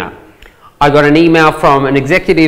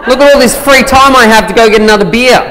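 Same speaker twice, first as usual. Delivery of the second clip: normal talking voice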